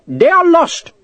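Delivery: high-pitched clerk's protest. A narrator's reading. Clerk to Sharp about horseshoes, protesting. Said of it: "the clerk protested".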